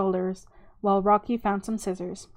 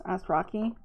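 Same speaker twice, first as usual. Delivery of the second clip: ordinary delivery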